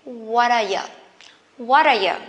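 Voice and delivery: reduced, joined-up "What are you"; linked words. Shown as a demonstration. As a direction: link "What are you" as one word. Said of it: "we can link them together".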